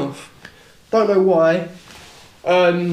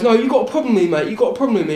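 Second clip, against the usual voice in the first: higher and louder, pushy and confrontational; aggressive accusation; 6 semitones higher, 4 dB louder, faster than usual. Retold or imitated. Knife-wielding lad accusing the speaker of having a problem, aggressive. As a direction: jab it at him like you want a fight. Knife guy insisting, picking fight.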